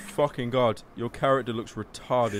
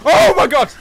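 very loud, high exclamation; real startled shout; shocked outcry; 14 semitones higher, 13 dB louder than usual. Real, not acted. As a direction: blurt it out at full volume. Startled by game event.

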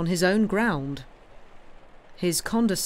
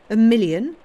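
much louder and higher, mocking disbelief; incredulous scoff; 5 semitones higher, 9 dB louder than usual. A narrator's reading. Count mocks the banker's million with loud disbelief. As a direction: throw the word million back in scorn.

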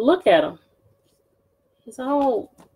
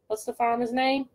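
ordinary delivery

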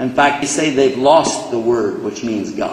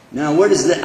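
ordinary talk

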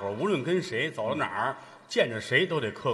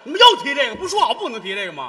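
loud, high comic outrage; mock-furious protest; indignant complaint; 12 semitones higher, 11 dB louder than usual. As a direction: scold them with raised voice. Complains after the outburst.

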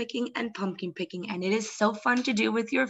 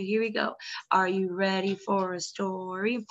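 plain reading voice

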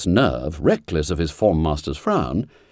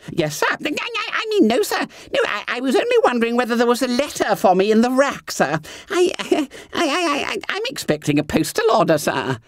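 high, squeaky, fast stammer; flustered fat boy stuttering excuses; stammering schoolboy; faster than usual. A narrator's reading. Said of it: "stammered Bunter".

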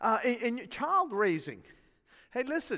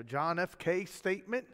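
usual speaking voice

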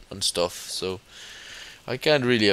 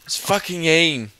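exasperated outburst; 8 semitones higher, 5 dB louder than usual. Real, not acted. Swearing at enemies in game.

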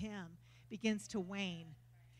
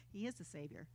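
normal talking voice

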